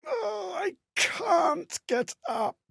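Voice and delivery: put-on high, slow, crying child's plea; a small child sobbing, too weak to stand. Exhausted child miner crying out to a cruel master, crying high and slow. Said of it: "far too young for such cruelty".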